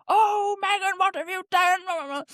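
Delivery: high, drawn-out mock parental dismay; exasperated parent. Parents reacting to their daughter's accident, mock dismay. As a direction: imitate a parent wailing over a mishap. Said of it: "they will come to you with".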